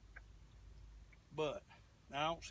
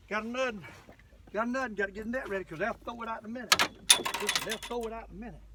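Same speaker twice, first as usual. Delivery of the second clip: excited; 4 semitones higher, 6 dB louder, faster than usual. Real, not acted. Fish biting, hurrying to recast.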